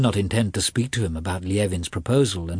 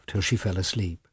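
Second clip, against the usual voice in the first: plain reading voice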